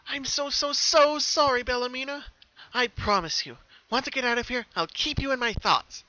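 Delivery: high, loud apology swelling on sorry; sorry then determined. Acted. Pinkie apologizing to her forgotten sister, loud and high. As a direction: plead sorry, then make a firm promise. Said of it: "determined now".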